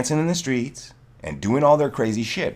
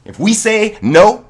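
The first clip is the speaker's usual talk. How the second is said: loud, fired-up declaration; real anger rising to a shout; heated shout; 3 semitones higher, 7 dB louder, slower than usual. Real, not acted. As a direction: bark it like a threat. Heated rant climax.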